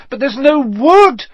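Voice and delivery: high, loud wail of dismay; panicked cry. A narrator's reading. Hermione wrings her hands over the fire, crying out. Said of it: "Hermione cried".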